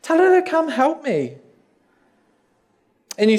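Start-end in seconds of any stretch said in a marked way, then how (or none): 0.0-1.4 high, indignant plea; hammed-up complaint, pitched high all through; agitated Martha. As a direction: protest up high, stretching the grievance. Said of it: "Martha gets so agitated".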